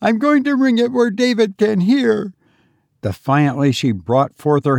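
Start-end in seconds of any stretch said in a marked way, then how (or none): 0.0-2.3 high, defiant wife voice; crying out. A narrator's reading. Wife threatening to ring the bell, crying out. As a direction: declare it defiantly, pitched high. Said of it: "she cried".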